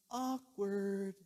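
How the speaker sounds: high, drawn-out sing-song comment; mock awkwardness. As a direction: stretch it out high, feigning discomfort. Acts out temple's embarrassed reaction.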